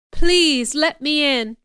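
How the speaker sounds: slow, high and loud pleading; begging. A narrator's reading. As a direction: drag out the plea in the rain. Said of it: "I begged".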